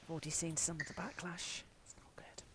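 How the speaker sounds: hushed whisper. Real, not acted. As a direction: whisper it like a secret. Hushed whisper about backlash.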